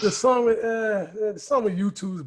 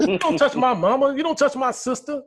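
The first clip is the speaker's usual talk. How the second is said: put-on higher-pitched childish taunt; mock three-year-old; 5 semitones higher than usual. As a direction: mimic a small kid's threat.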